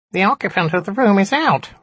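high, squeaky little imp; squeaky nasal voice. A narrator's reading. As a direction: squeak it out through the nose. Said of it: "it squeaked again".

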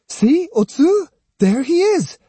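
put-on very high boyish shout of discovery; excited cry. A narrator's reading. Jotaro spotting Musashi and crying to Otsu, excited. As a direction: cry out as you spot him. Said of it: "caught sight of him and cried".

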